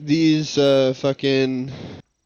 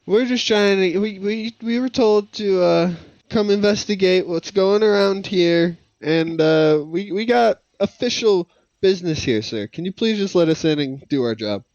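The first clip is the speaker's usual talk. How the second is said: higher-pitched, stammering appeal to authority; placating plea; 6 semitones higher than usual. Acted. Player begs the guard to let them in, placating. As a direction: talk the guard down, flustered and polite.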